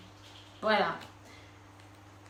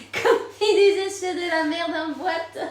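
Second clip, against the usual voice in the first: singsong line through high laughter; laughing; 8 semitones higher, 8 dB louder than usual. Real, not acted. Reader cracking up at her own phrase, laughing. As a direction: laugh out the phrase as you sing it.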